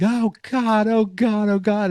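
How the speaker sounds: mock yelling. Wounded Santa wheeled in on a gurney, mock yelling. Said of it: "he's yelling".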